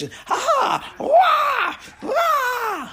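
high yelping strike noises; mock kung fu yells. Retelling the mirror room fight with mock kung fu yelps. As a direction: yelp the fight moves out.

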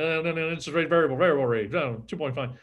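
rapid-fire auctioneer patter. Retold or imitated. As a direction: rattle it off like an auctioneer. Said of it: "like an auctioneer".

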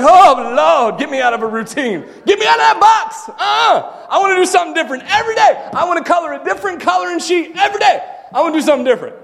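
high, shouted mock desperation; frantic plea. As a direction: beg loudly to be let out, hammed-up. Acts out frantic plea against routine.

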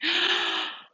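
sharp frightened gasp; startled gasp. Real, not acted. Startled by the printer, a sharp gasp. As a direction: gasp at a sudden noise.

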